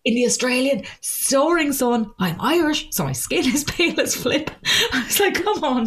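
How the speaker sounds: indignant. Retold or imitated. Complaining about wasted date.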